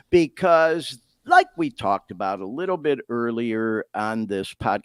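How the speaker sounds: ordinary delivery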